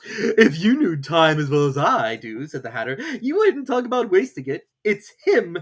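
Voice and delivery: hint mysteriously; Hatter. A narrator's reading. Hatter voiced.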